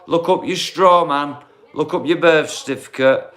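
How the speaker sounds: usual speaking voice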